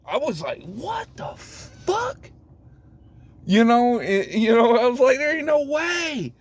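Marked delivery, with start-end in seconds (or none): 0.6-2.3 slow squeaky high incredulous cry; disbelief. Retold or imitated. Realizing the raids were real, a slow squeaky cry of shock. 5.2-6.3 incredulous. Retold or imitated. Retold denial, high.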